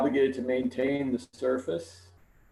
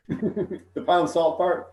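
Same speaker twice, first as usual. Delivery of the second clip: laughing; 5 semitones higher, much slower than usual. Real, not acted. Laughing about the minutes joke.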